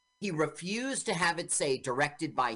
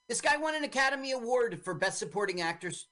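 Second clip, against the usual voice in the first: ordinary talk, nothing marked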